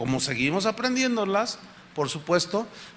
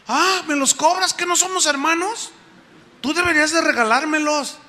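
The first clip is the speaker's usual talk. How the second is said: indignant brother; 8 semitones higher than usual. Retold or imitated. Brother indignant at being asked to repay.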